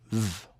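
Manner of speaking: "v" as a buzzing voiced consonant. Shown as a demonstration. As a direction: make only the "v" sound, held with voice. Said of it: "is a voiced sound".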